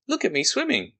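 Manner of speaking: high, proud squeaky little Roo. Acted. Roo's voice in story.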